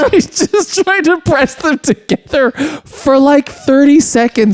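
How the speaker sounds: high, slowed, laugh-strained telling; real laughter. Real, not acted. Describing a favorite movie bit, barely holding back laughter. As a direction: squeeze the words out while cracking up.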